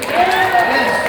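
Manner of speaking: loud, high triumphant shout; shouted yes. Real, not acted. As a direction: belt out a big yes. Crowd affirmation shout.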